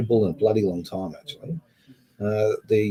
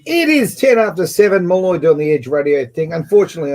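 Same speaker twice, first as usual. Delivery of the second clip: radio announcer; 6 semitones higher, 4 dB louder than usual. Acted. Radio host intro.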